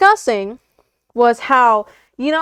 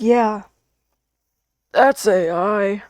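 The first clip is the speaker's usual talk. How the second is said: lower, slow, dopey drawled verdict; mock dismissive; 4 semitones lower, much slower than usual. Mimicking critics who call punctuation AI, mock dopey. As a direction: play a smug know-it-all.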